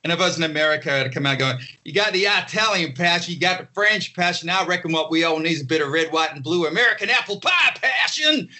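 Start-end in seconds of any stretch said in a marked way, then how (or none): 1.8-8.5 higher, hammed-up American showman, shouting the finish; mock American. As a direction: sell apple pie passion in a hammy American accent, ending in a shout. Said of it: "you gotta adopt the local accent".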